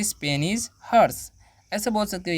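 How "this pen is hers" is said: "This pen is hers" said slowly, word by word; slow teacher dictation. Shown as a demonstration. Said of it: "तो बोलेंगे".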